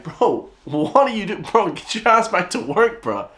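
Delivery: high, slow mock telling-off; mock scolding. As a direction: boss him back to work, hammed up. Scolding the singing clerk.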